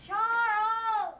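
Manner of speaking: long high call up the stairs. Acted. Mother calling her son from downstairs, high and drawn out. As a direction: call him from another room.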